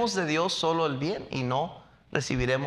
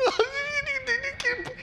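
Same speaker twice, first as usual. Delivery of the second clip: mock giggle. Giggle inside the mocked voice.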